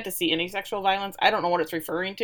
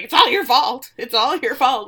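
loud, higher accusing shout at her husband; furious accusation; 4 semitones higher, 7 dB louder than usual. Retold or imitated. Mother yelling at the dad that it's his fault, loud and furious. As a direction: blame him at the top of her voice. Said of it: "and she is furious".

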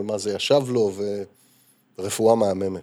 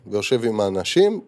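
ordinary talk

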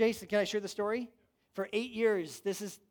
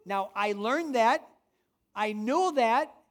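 emphatic preaching; 3 semitones higher, 6 dB louder than usual. Real, not acted. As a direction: drive it home to the congregation.

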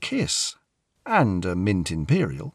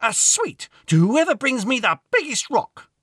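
put-on bossy little girl; 14 semitones higher, 4 dB louder than usual. A narrator's reading. Girl offers prize to kids.

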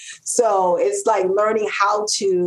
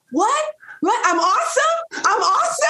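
disbelieving delight. Retold or imitated. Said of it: "And I'm like".

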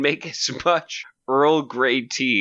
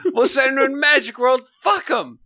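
mocking voice; 11 semitones higher, 3 dB louder than usual. Mocking villains, high and loud.

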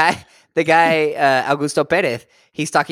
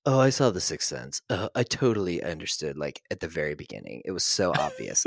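lower, fading smug boast in a put-on voice; mock smug know-it-all; 6 semitones lower, 6 dB quieter than usual. Mocking friends who claim they guessed the twist, smug and lowering. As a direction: sneer at the people who claim they knew.

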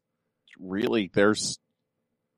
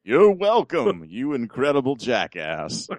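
put-on announcer voice; 3 semitones higher than usual. Host answering the co-host's thanks, hammed-up announcer voice. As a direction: stretch it out like a pompous awards host. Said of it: "keep up that voice".

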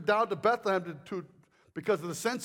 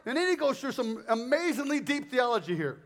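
ordinary talk

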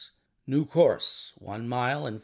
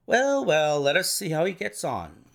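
usual speaking voice

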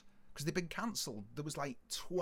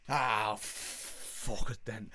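long drawn-out, slow, lower sigh of surrender; resigned giving in; 5 semitones lower, much slower than usual. Retold or imitated. Driver accepting the cash, resigned. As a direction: drag it out as you relent.

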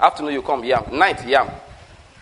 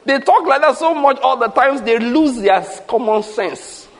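usual speaking voice

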